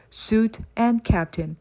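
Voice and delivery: ordinary delivery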